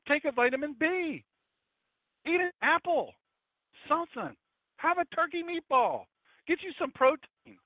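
high, mocking voice of a tired preacher. Pastor mocking colleagues who tire from short sermons, in a high mocking voice. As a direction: lampoon the worn-out pastor in a high squeaky voice. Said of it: "Angie saying I need to use my special voice".

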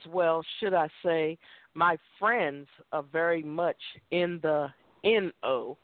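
the "N O" spelled out slowly, letter by letter; spelling out no. Retold or imitated. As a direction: spell the N O out, drawing each letter. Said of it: "he says".

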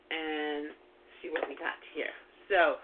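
sniffing between words; little sniffles while talking; quick sniff. Real, not acted. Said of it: "pardon the sniffing".

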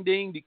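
ping it out like a phone notification; imitated phone ding. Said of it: "my phone's going ding".

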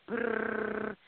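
lip-trilled motor noise; imitated boat motor. Entertainer showing friends a boat puttering out, imitated motor. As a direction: buzz like a little outboard motor.

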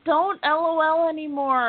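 exasperated, drawn-up plea to stop; playful scolding. Real, not acted. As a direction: scold your co-host in mock exasperation. Scolding co-host for saying LOL.

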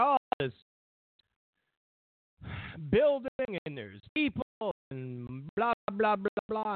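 dramatic TV reporter, lightly mocked; mock news voice. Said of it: "and it was like".

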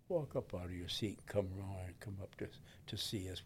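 hushed, breathy invitation to come forward; soft voiceless call to step up; whispered altar call. Retold or imitated. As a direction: whisper it like a gentle plea. Preacher's altar call retold softly.

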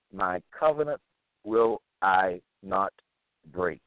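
measured restatement, each word set apart; word-by-word emphasis. Real, not acted. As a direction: repeat it deliberately, one word at a time. Repeats the verse word by word.

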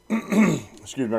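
rough throat, clearing it out; hoarse catch in the throat; frog in throat. Real, not acted. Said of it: "I got a little frog in my throat".

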